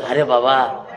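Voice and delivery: low imitated sigh of a mother; lightly acted low sigh; mother's weary sigh. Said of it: "মা নিঃশ্বাস ফেলে".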